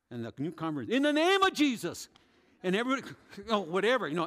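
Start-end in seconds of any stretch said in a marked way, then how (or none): 0.9-2.1 loud, high cry of faith; fervent shout. Retold or imitated. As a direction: shout the name of Jesus over him. Convert shouting prayer over body.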